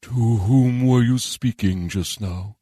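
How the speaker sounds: slow, stately dragon voice; questioning dragon. Acted. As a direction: ask slowly as a great old dragon. Dragon questions Charon in story.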